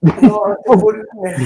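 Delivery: sudden loud joking protest. Real, not acted. Friend teased about his cooking, loud mock protest. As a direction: blurt it out loud in mock defence. Teasing about lynx in curry.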